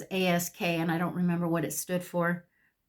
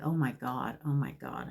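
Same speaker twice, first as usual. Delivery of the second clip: low, lightly voiced counselor; muttered dismay; 5 semitones lower, 4 dB quieter than usual. Counselor reacts to her honest answers, lightly mocked in a low voice. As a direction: drop low for a mild, retold dismay.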